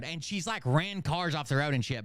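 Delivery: ordinary talk, nothing marked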